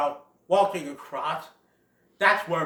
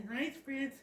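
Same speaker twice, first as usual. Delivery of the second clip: soft, high little chuckle into words; nervous chuckle; 9 semitones higher, 10 dB quieter than usual. Acted. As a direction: chuckle weakly to placate an angry grown-up. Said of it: "gives a chuckle and says".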